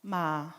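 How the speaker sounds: "Ma" said low and level; Thai tone demo. Shown as a demonstration. Showing the audience three Thai tones, low level "Ma". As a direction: say "Ma" on a low, even tone. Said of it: "São cinco tons, mas eu vou falar só três".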